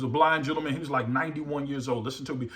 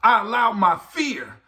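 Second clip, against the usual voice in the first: fervent preaching; 5 semitones higher, 5 dB louder than usual. Real, not acted. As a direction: drive each phrase home to the congregation. Preaches at full force.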